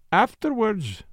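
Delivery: a drily voiced objection; dry. A narrator's reading. Poirot answering the inspector, dryly. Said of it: "said Poirot dryly".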